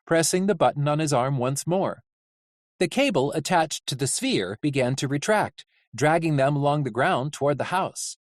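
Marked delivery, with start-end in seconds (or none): none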